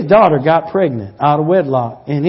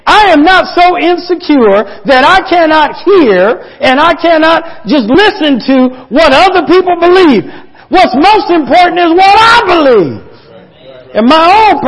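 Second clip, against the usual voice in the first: loud and much higher, fervent; preaching at full force; 9 semitones higher, 4 dB louder than usual. Acted. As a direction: drive it home from the pulpit.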